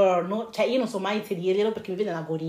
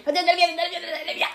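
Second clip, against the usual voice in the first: high repeated whining; mocking whine; 8 semitones higher than usual. Mocking her friend nagging to leave, whiny. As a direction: mimic her impatient nagging. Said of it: "se ti fai".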